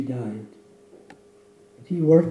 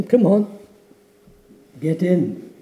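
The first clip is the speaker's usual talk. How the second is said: louder, higher call to hurry; impatient urging; 4 semitones higher, 6 dB louder than usual. Acted. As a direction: hurry him aboard, raised voice. Pilot urging the man.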